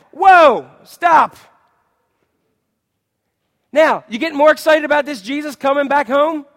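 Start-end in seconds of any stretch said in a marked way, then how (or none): 0.1-1.4 loud, high "whoa, stop"; shocked halt. Real, not acted. Preacher stopping at Jesus's shocking words, loud exclamation.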